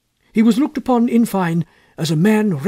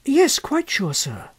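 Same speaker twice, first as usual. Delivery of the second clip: put-on higher boy's voice, politely answering; lightly voiced schoolboy replying to his elder; polite young boy; 8 semitones higher, slower than usual. A narrator's reading. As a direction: answer like a shy, well-mannered boy.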